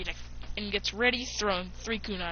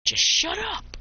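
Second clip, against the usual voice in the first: higher, louder burst of annoyance; angry snap; 10 semitones higher, 6 dB louder, slower than usual. Acted. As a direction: cut them off with a raised, irritated voice.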